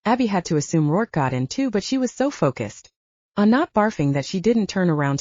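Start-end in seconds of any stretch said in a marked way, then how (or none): none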